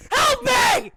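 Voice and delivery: high, very loud cry for help; panicked scream. Retold or imitated. Hurt player begging teammate for help, panicked scream. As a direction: scream it out in hammed-up desperation.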